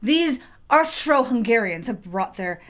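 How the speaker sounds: loud slow cursing; angry grumbling rant. Acted. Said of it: "The artificer cursed".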